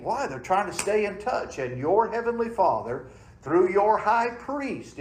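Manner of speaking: fervent preaching. Acted. Pastor preaching to his church, high and loud. Preacher builds to full force.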